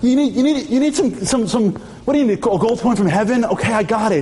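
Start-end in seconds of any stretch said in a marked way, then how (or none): none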